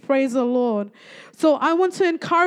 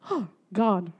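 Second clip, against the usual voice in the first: low, soft, heavy-hearted sigh to God; troubled sigh; 5 semitones lower, 6 dB quieter than usual. Retold or imitated. Troubled speaker turns to God alone in their room, quietly despondent. Sighed prayer in troubled retelling.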